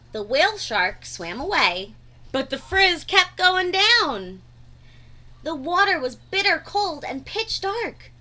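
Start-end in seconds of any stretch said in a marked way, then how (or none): none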